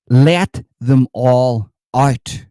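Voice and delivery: slow, loud, drawn-out call to free them; drawn-out cry. Pretend. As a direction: stretch every word like a rally chant. Mock zealot rallying cry.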